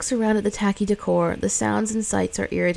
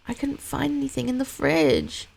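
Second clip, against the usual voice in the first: put-on higher little boy voice, pleading; young boy; 6 semitones higher than usual. Sick son asking his mother for food, small higher boy voice. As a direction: play the son, small and needy.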